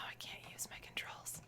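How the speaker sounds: frustrated whisper under the breath. Real, not acted. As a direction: mutter it to yourself in a whisper. Controls fail, muttered frustration.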